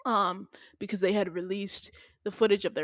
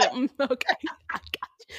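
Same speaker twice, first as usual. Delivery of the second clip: mock hushed reply; 4 semitones higher than usual. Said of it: "not just a".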